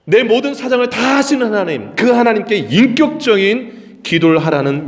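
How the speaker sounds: high, forceful sermon delivery; emphatic preaching. Real, not acted. Preacher urging congregation to pray personally to God, emphatic. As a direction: drive each point home, stretching key words.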